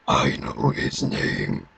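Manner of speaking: very low, slow, menacing villain; the Kurgan. Acted. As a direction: drag it out deep and slow. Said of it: "I can do an impression of the Kurgan".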